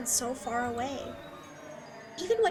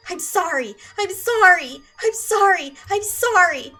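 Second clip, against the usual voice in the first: loud, high, panicked sorry; an apology shouted in hysterics; hysterical apology; 4 semitones higher, 7 dB louder than usual. Acted.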